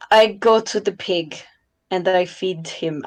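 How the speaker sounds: usual speaking voice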